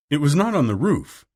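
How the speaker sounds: usual speaking voice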